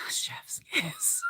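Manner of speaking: sighed, soft whisper; swooning whisper. Real, not acted. Gushing about Idris Elba to viewers in a sighing whisper. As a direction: breathe it out like a dreamy sigh.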